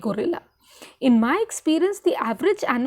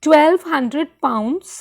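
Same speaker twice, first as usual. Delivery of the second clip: wary admission; 2 semitones higher, 6 dB louder, much slower than usual. Acted. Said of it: "and waited for the storm".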